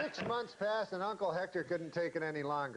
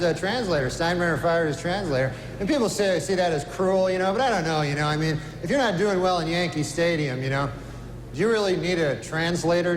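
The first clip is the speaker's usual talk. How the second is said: loud, brash stand-up host working the crowd; awards host monologue; 13 dB louder than usual. Acted. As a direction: play to the room like an awards show host. Stand-up host monologue, louder, announcer.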